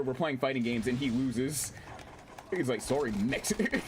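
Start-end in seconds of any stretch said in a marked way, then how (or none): 2.8-3.8 "Sorry, Mexi-" dragged out slowly; heavy emphasis. Retold or imitated. Streamer quoting the meme to chat, emphatic before laughing. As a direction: slowly imitate him, leaning on "Mexi-". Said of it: "he said it with so much emphasis".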